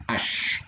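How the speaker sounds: "H." as a pure unvoiced breath of air; just breathe out the h; breathy h sound. Shown as a demonstration. Teaching a viewer the Korean h, voiceless breath. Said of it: "c'est le son he".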